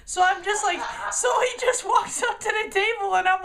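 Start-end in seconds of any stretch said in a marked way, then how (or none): none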